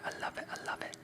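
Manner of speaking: ordinary talk, nothing marked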